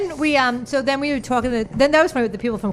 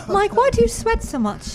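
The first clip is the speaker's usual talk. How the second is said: mock posh lady; 12 semitones higher than usual. Improvised little play.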